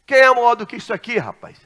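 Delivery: loud, fast, high challenge; challenging retort. Retold or imitated. Taxi driver challenging the pastor, loud and fast. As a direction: throw the question at him defiantly.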